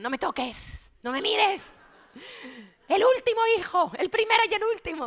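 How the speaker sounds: high, loud labour-pain outburst at her husband; gritos de parturienta. Retold or imitated. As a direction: yell it in exasperation, pitch shooting up. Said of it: "yo le decía a Carlos". (gritando a su marido, desesperada de dolor)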